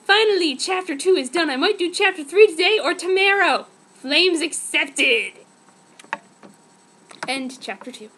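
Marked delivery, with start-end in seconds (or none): 0.0-5.3 mock excited author. Reader mocks author's note.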